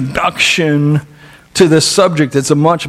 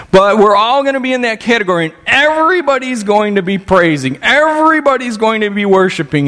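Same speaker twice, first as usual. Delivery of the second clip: higher, sweeping preacher delivery; fervent preaching; 6 semitones higher than usual. Real, not acted. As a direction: preach it to everyone, pitch lifted high. Preaches that all will worship.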